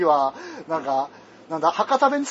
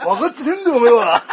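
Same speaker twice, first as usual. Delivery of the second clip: higher, louder hammed-up actor's outburst, laughing; Matsuda Yusaku-style rant; 3 semitones higher, 7 dB louder than usual. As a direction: throw the line like an actor hurling a script. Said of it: "松田優作があの、台本投げつけるぐらいのノリ". (松田優作風に台本を投げつけるような誇張した怒鳴り)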